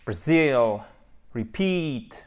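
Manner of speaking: BRAZIL and REPEAT, each with its last syllable strong; falling models, "bruh-ZIL", "ri-PEEET"; second-syllable stress. Shown as a demonstration. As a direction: hit ZIL and a long PEAT. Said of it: "seconde syllabe qui va être celle qui est accentuée".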